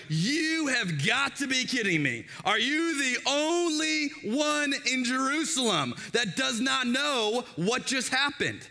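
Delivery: you can't be serious, drawn out; sarcastic disbelief. Acted. Cleopas mocking the stranger, slow sarcastic disbelief. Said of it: "this is sarcasm in the Bible".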